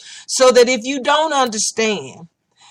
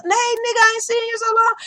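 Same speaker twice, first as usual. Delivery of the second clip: much higher, mock hearty greeting; excited greeting; 12 semitones higher, faster than usual. Club friends greeting him, high and excited. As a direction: ham up a friend's happy hello.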